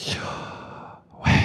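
"Yahweh" as pure breath, inhaled and exhaled, no voice; breathed pronunciation. Shown as a demonstration. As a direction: breathe "Yahweh" slowly, one whispered breath per syllable. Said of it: "breathe the name of God".

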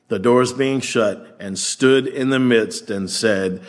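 ordinary talk

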